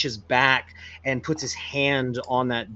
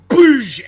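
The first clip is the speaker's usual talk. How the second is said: loud higher boosh for an axe blow; smash sound effect; 12 semitones higher, 14 dB louder than usual. Acted. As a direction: punch out an impact noise. Axe hits window.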